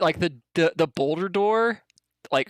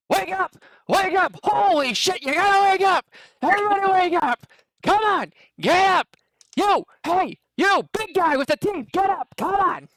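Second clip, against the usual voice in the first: Gilbert Gottfried scream; 12 semitones higher than usual. Acted. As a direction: shriek everyone awake in a panic. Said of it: "hears Gilbert Godfrey screaming at the top of his lungs".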